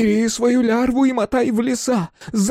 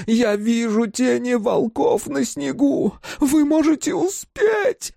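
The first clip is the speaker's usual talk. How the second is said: shrieking mother; slower than usual. A narrator's reading. Mother shrieks at her son to flee before the wolves. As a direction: scream at him in rage and terror. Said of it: "Голос матери сорвался на визг".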